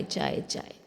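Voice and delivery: whispered grumble; unvoiced irritated whisper; muttered under breath. A narrator's reading. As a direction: mutter it to yourself, annoyed. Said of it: "मन ही मन बड़बड़ाते हुए".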